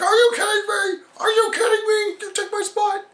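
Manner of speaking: mock The Miz with a high whine; Sandow mimicking Miz. Sandow mimics The Miz's catchphrase, high and whiny. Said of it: "the mimicking thing".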